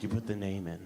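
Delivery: very low, secretive delivery; hushed reveal. Retold or imitated. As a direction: unveil it deep and understated. Songwriter reveals the twist.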